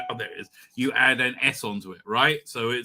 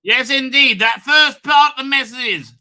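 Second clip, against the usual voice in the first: higher, louder mock-solemn declaration; hammed-up grandeur; 10 semitones higher, 11 dB louder than usual. Continuing the cosmic message to viewers, higher, louder and mock-grand. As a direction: proclaim it like a prophet.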